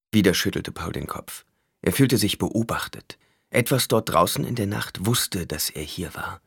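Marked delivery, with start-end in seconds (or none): none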